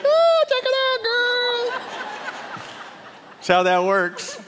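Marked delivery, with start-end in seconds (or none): 0.0-2.6 very high, drawn-out squeal; hammed-up girly shriek of delight; squealing bride-to-be. Newly engaged woman showing girlfriends, hammed-up squeal. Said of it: "If it was you, you'd be like".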